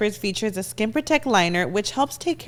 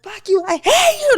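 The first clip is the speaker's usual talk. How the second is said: high-pitched imitated screaming; retold shriek, pitched way up; mock scream; 13 semitones higher, 4 dB louder than usual. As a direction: act out her screamed outburst. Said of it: "like, screaming".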